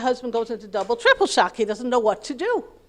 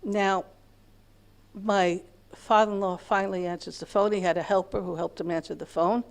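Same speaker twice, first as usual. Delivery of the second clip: usual speaking voice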